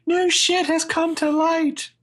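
high, drawn-out comic voice; mock Lebowski quote. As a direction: stretch it out high, hammed up. Imagines Sarah quoting Big Lebowski.

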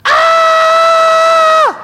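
high, loud shrieking scream; full-throated shriek of fright. Retold or imitated. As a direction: let out a startled shriek at the top of your voice. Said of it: "she cried out at the top of her voice".

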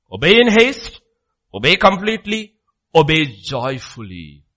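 loud, high rallying charge, slowing at the end; ringing exhortation. Real, not acted. Preacher exhorting the church to obey, loud. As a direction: drive each command home.

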